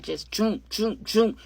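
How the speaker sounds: playful onomatopoeia for stabs; imitated knife jabs. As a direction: mimic the knife, short sharp sounds. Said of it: "three little quick voop".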